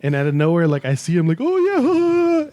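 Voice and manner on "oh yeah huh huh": high-pitched mock chatter; mimicked oblivious friend.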